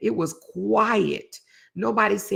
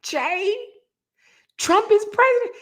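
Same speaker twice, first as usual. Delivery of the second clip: high loud urgent calling; mock panicked shout at husband; urgent wake-up call; 3 dB louder than usual. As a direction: shake him awake with the news. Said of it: "I'm shaking him".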